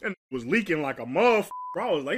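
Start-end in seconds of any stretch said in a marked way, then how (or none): none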